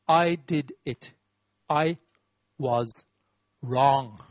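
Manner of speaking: slow, each word set apart; halting confession with long gaps before "was" and "wrong"; labored admission. Shown as a demonstration. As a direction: force out "I was wrong" word by word, dropping low. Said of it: "Three hardest words to pronounce".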